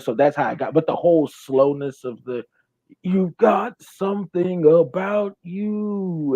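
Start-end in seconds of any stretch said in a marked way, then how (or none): 3.0-6.3 slow, high imitation of a distorted voice; slow distorted demon voice. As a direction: drag it out in a warped demon voice. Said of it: "this distortion on his voice".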